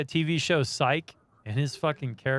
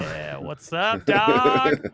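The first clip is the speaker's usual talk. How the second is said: mock bro greeting; 4 semitones higher, 5 dB louder, much slower than usual. Teasing friend in bro voice.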